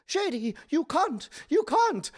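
high-pitched mock British accent; affected British accent. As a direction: scold the dog in a put-on British accent. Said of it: "was affecting a British accent".

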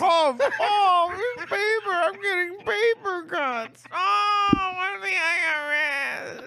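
mock crying. Mock sobbing over tax paperwork.

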